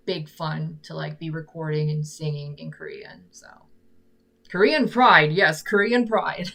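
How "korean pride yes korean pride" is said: proud cheer. Real, not acted. Cheering for singing in Korean.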